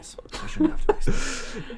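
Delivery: high wheezing laugh with no voice; a soundless fit of giggles; breathy laugh. Real, not acted. Laughs after crediting the crew.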